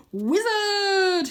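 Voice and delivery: high, loud triumphant cry; loud high excited wizard cry; cried out. Acted. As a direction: shout it like a grand reveal. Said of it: "he cries".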